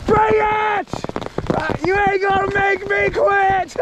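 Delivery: high, excited dare; defiant cheer. Real, not acted. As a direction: hiker daring the hail storm, pumped up. Defying the hail.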